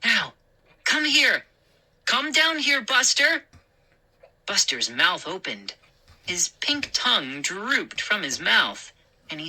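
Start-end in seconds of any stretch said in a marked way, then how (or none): 0.0-3.5 calling dog. Acted.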